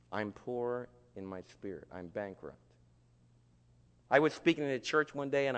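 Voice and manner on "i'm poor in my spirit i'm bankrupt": low, hushed, slow admission; humble confession. Acted. Humbled sinner admitting spiritual bankruptcy, quiet and low. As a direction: confess it softly, sinking lower and quieter.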